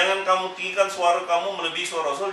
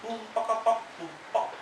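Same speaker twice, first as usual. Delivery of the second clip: drum imitation; 5 semitones higher, 6 dB quieter than usual. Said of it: "Ini dengan kompang".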